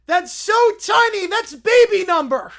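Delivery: put-on high, loud, slow childlike bear; Little Brown Bear voice. Little Brown Bear marveling at the one point, high and slow. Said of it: "I don't know why he talks like this".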